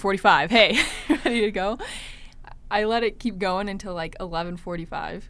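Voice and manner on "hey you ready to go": laughing mid-question; retold through laughter. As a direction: quote him, cracking up halfway through. Retold nagging question, breaking into laughter.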